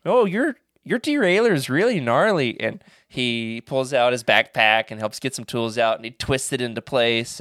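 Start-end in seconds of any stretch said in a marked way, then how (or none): 0.1-2.6 put-on Canadian accent. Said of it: "I'm going with Canada".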